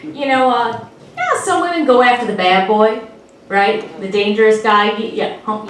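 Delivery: ordinary delivery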